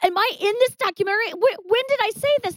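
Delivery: high, fast, frantic questions; retold freak-out, high and rushed; panicked disbelief. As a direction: rattle it off in a rising panic. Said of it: "I go".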